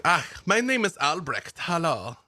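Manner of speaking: chatty eccentric woodsman, pleased with himself; lightly German-accented cheerful stranger; Albrecht the nudist. Acted. GM voices Albrecht NPC.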